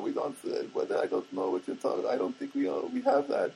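lightly imitated foreign counter girl; put-on high, halting shopgirl voice; mock confused foreign girl. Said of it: "She's kind of a foreign kind of girl".

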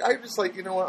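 ordinary talk

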